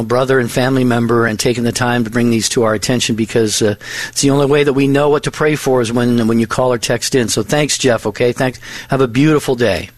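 usual speaking voice

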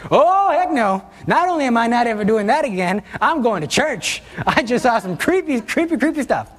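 high, panicky voice of someone spooked; a frightened, half-laughing rush of words; scared exclamation. Retold or imitated. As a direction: act freaked out and fleeing to church. Scared person swearing off Ouija.

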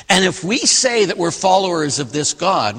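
ordinary delivery

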